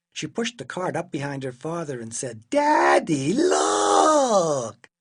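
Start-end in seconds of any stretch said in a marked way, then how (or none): 2.5-4.9 put-on loud, high child's shout with a long "look"; big excited holler; excited calling out. A narrator's reading. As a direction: call out to Daddy, stretching the last word. Child calls out to father.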